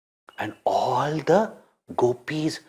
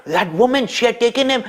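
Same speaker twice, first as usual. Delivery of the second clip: alarmed accusation; 8 semitones higher, faster than usual. Acted. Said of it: "they said".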